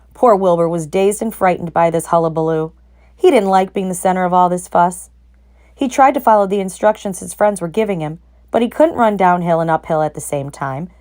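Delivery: ordinary delivery, nothing marked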